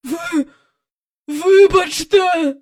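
high, slow, strained plea; forced apology. A narrator's reading. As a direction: squeeze the words out with effort. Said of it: "насилу промовила вона".

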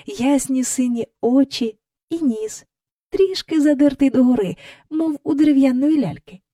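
ordinary delivery

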